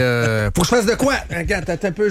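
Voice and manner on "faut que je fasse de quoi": high-pitched politician imitation; Fréchette. Host breaks in to imitate a politician, high-pitched impression. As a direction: put on a high, questioning politician's voice. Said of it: "voulez-vous que je l'imite, euh, Fréchette?".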